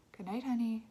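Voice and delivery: ordinary delivery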